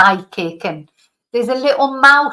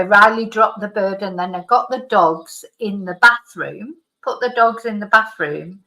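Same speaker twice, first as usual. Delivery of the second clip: ordinary talk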